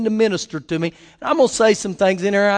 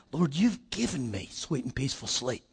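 soft prayer; 7 semitones lower, 12 dB quieter than usual. Retold or imitated. Quoting his own bedtime prayer.

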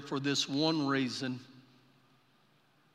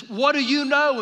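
urgent demand; 9 semitones higher, 11 dB louder than usual. Retold or imitated. Brother demanding the word.

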